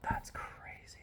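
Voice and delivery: hushed whisper of amazement; awed whisper. Real, not acted. Reader looking at the cool imagery, awed whisper. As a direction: whisper it, drawn out in awe.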